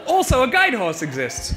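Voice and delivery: high, loud announcer-style reveal; clickbait video narrator. Acted. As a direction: deliver it like a clickbait voiceover. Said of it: "It's like a clickbait title".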